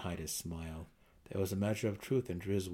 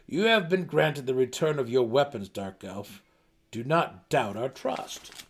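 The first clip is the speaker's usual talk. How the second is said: loud, firm reassurance; 4 semitones higher, 7 dB louder than usual. Acted. Belwer assuring Driz, loud and firm. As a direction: assure him squarely.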